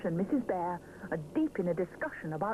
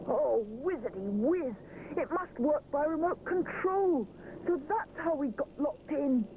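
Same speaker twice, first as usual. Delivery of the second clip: amazed realisation; 8 semitones higher than usual. A narrator's reading. Rupert discovers remote control.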